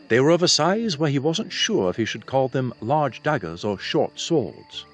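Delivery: usual speaking voice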